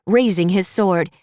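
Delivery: ordinary delivery, nothing marked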